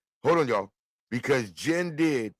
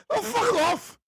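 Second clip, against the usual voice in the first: high-pitched mimicked comedy outburst; Robin Williams; 4 dB louder, slower than usual. Quoting a comedian's routine for laughs, high mimicry. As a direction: throw it out high, imitating a comic. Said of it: "That reminded me, Robin Williams".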